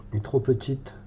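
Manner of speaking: ordinary talk, nothing marked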